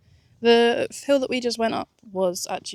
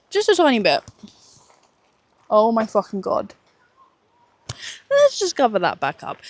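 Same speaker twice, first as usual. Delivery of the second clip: shocked exclamation; 2 semitones higher, 6 dB louder than usual. Real, not acted. Shows sunburn, reacts loudly.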